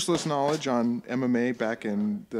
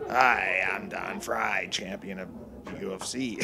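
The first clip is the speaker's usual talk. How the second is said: lower, softer fighter impression; low put-on voice, dropping quieter; Don Fry; 5 semitones lower, 3 dB quieter than usual. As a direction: introduce yourself as a mock tough champion. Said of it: "work on my Don Fry".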